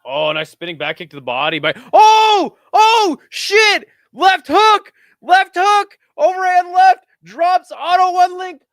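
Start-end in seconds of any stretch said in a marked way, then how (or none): 1.9-8.6 much higher and louder, shouting the knockdown live; excited shout. Real, not acted. Streamer calling the knockdown to chat, in an excited shout. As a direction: yell every punch as it lands.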